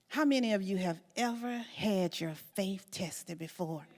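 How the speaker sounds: ordinary talk, nothing marked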